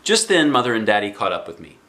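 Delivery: usual speaking voice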